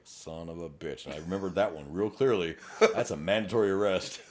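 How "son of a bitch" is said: muttered curse. Retold or imitated. Realizing mandatory arrest.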